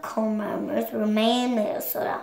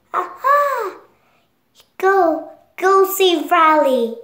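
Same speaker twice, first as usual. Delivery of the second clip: urging cheer; 6 semitones higher, 4 dB louder than usual. Acted. Bing Bong urges Joy onward.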